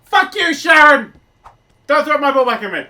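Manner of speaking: furious yell at the screen; angry shout. Real, not acted. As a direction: bellow it in outrage. Yelling at game character.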